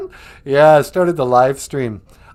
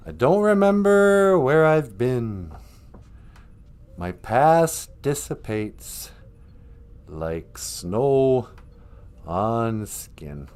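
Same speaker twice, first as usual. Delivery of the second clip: recited lyric; 4 semitones lower, slower than usual. Acted. Reading his own song lyric.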